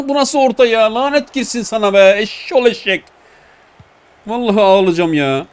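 high, loud exasperated rant; high-pitched loud frustration; laughing rage. Real, not acted. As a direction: vent at the useless cross, half laughing. Gamer raging at bad cross.

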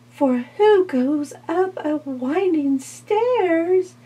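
very high, slow little fly voice; housefly. Acted. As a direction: plead it in a tiny high voice. Fly refuses the spider.

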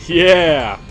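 loud triumphant yeah; excited cheer. Real, not acted. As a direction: cheer the win. Trick works again.

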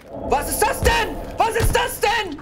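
high, loud disbelieving yell; shocked shout. Real, not acted. Shocked by a running spare rib.

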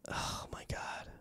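breathless slow whisper; barely voiced slow oh my God under the breath. Real, not acted. Recalling the film to listeners, hushed slow whisper. Recalling Jared Leto's performance.

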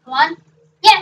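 ordinary delivery

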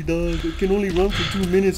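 ordinary delivery

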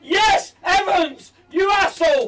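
high, loud jeering cheer; excited shout. Real, not acted. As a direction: yell in triumph. Celebrating, insulting the defender.

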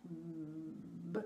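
"B" held long, a buzzing voiced b between closed lips. Shown as a demonstration. Speech therapist to parent, a long humming b. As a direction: hum the "b" through closed lips so the lips vibrate. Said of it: "ressentir la vibration ... des lèvres".

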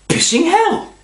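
high, loud imitated outburst; shouted exclamation. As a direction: shout it like a sudden curse from across the shed. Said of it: "And he shouted out".

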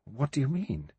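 unsteadily. A narrator's reading. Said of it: "She said unsteadily".

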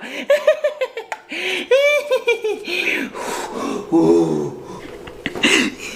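high scheming giggle; gloating laugh. Acted. Woman gloating over her rat poison trap, evil giggle.

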